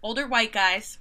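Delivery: normal talking voice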